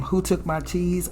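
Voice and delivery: ordinary delivery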